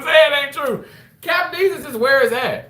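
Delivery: high, loud pleading; mock despair. Real, not acted. Host begging a viewer to deny skipping Captain D's.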